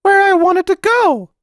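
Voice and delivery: put-on curt, high boyish retort; rudely. A narrator's reading. Boy answering his mother rudely in high child voice. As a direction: brush the question off like a sulky child. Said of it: "he said rudely".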